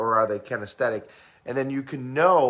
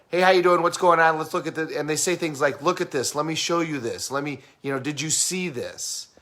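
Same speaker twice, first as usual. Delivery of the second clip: fast talker; 3 semitones higher than usual. Acted. Trainer mimicking visual people who talk quickly, fast talker. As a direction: rattle it off like someone always on the move. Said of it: "they talk quickly, they move fast".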